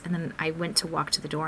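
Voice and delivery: normal talking voice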